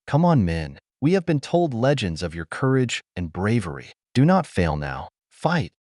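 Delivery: plain reading voice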